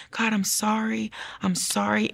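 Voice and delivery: soft apology. Retold or imitated. Apologizing to God in her mom's basement, soft apology. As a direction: plead quietly, as in private prayer. Retold tearful prayer of apology.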